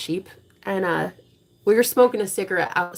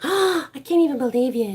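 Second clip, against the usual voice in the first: higher put-on voice of a shocked mom; imitating mom; 7 semitones higher than usual. Mom in the front pew, mock disbelief. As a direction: play the outraged devout mother. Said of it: "She was basically just like".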